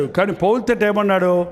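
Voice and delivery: ordinary talk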